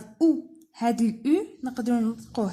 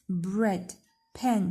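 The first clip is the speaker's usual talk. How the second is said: ordinary talk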